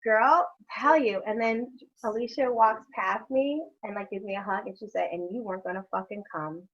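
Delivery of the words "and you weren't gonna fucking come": quiet, dry teasing jab; a low ribbing aside to a friend who almost skipped. Retold or imitated. Friend teasing her at the hug, said low and dry. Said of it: "that's exactly how she said it".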